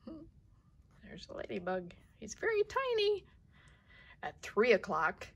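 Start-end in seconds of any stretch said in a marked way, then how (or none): 2.2-3.2 tiny squeaky voice. Real, not acted.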